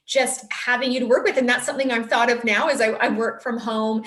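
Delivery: ordinary talk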